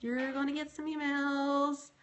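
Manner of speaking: slow, high-pitched teasing to hubby; teasing sing-song. Acted. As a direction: tease him sweetly, drawn out. Teasing husband about coming emails.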